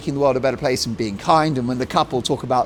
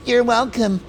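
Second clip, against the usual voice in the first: higher put-on sign-off; mock vampire voice; 6 semitones higher than usual. Host ends the vampire Meghan bit, high mocking voice. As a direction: say it high and affected, in parody. Closes the Dracula parody.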